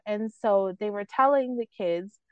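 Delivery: usual speaking voice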